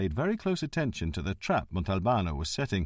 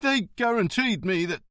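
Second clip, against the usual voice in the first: higher, blurted out in distress; upset blurting; 11 semitones higher, 3 dB louder than usual. Acted. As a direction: lets the secret slip in panic. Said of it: "very upset".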